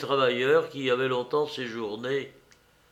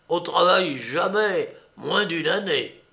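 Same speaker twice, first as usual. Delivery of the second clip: higher, firm voice of the valley's master; lightly hoarse master, raised voice; muffled, hoarse master; 5 semitones higher, 4 dB louder than usual. A narrator's reading. Master setting work terms for Martial, lightly hoarse. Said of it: "la même voix à la fois assourdie et rauque".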